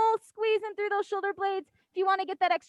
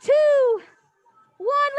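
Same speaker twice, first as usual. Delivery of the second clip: shouted count, high and loud; loud countdown; 4 semitones higher, 6 dB louder, much slower than usual. Real, not acted. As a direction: call the count out over the music. Counting down the workout.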